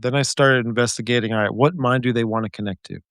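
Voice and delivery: normal talking voice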